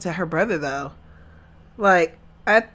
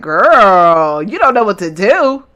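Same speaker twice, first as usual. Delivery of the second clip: exasperated telling-off; 4 semitones higher, 5 dB louder than usual. Acted. Viewer telling the crying mother to handle it, exasperated. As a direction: scold her in exasperated disbelief. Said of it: "that just rubbed me the wrong way".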